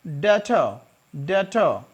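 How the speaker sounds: डेटर said as det-uh; b silent. Shown as a demonstration. Teacher showing silent b in debtor.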